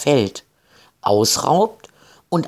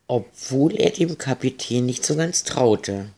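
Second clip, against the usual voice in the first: ordinary delivery, nothing marked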